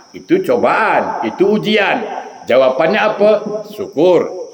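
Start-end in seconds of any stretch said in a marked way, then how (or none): none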